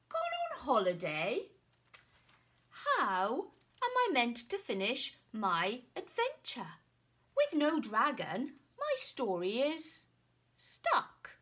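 loud, rising outrage; indignant knight. Acted. Knight loudly upset that the dragon left. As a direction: complain loudly, voice climbing.

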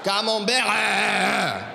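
loud mock French with hugely stretched endings; exaggerated French. Comedian mocking how French sounds, exaggerated French accent. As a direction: hold the French vowels wide open, very slow. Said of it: "die haben gern Schwänze im Mund".

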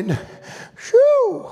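high, drawn-out "whew"; high whoop. Real, not acted. Preacher overcome by the shepherd's words, whooping.

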